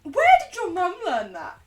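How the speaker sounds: loud, high-pitched disbelief; incredulous outburst. Real, not acted. Streamer baffled by a viewer's mum's habit, incredulous.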